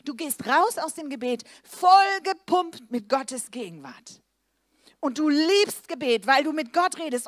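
slow, loud, high sermon build; fervent preaching. Acted. Preacher urging the congregation to love prayer, loud and slow. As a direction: drive each word home from the pulpit.

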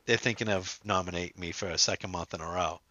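low, quiet deadpan retold brag; monotone impression. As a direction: drone it out with no lift. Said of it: "he's so monotone".

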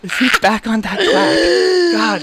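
high and slow, squeezed through laughter; cracking up while mocking the quack; laughing tease. Real, not acted. Host teasing himself while laughing, squeaky and slow.

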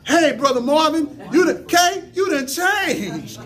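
high, loud preacher's cry with drawn-out phrases; full-force preaching. Real, not acted. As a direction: build a chant-like refrain at the top of the voice.

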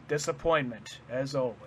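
quiet, cold and flat disappointment; cold dismissal. Retold or imitated. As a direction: drop it coldly like a distant father. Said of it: "the cold, like, distant dad".

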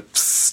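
voiceless hiss of searing; quick "psst" sizzle effect; imitated sizzle. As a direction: make a short sizzling noise through the teeth. Imitates searing finger on press.

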